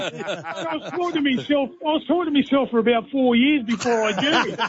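words bubbling through real laughter; laughing while talking. Real, not acted. To the hosts about the prank, laughing.

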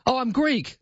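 light high-pitched driver voice; a lightly voiced cheerful driver; taxi driver voice. Taxi driver answers him.

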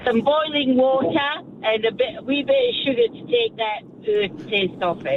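real Scottish lilt; easygoing Scottish burr; Scottish accent. Real, not acted. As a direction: speak in a broad Scottish accent. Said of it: "how good's that accent".

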